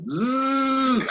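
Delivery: high-pitched buzzing drill imitation; drill noise. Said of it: "I hear a drill".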